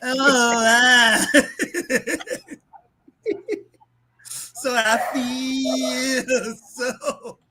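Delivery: high, slow laugh-sobbing whine; hammed-up sobbing imitation of the singer; mock crying. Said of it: "I'm like, come on".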